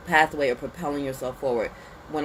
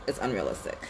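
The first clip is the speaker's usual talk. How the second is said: "It's unrealistic" said again, lower and softer; quieter repeat; 5 semitones lower, 5 dB quieter than usual. Shown as a demonstration. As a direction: let the repeat drop down and soften.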